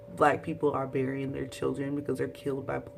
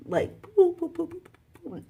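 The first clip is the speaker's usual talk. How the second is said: high, loud boop noises for slaps; slap sounds; 13 semitones higher, 3 dB louder than usual. Retold or imitated. Slapping the character, high loud boops.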